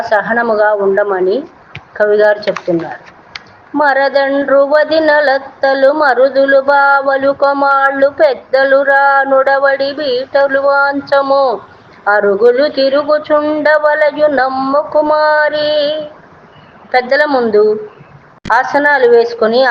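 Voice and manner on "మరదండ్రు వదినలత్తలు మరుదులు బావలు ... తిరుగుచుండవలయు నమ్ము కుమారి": slow, sing-song chanting of a classical verse; slow chanted Telugu poem; poem recitation. Acted. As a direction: intone the padyam slowly and evenly, like a recital.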